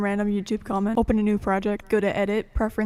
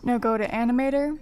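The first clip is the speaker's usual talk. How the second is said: normal talking voice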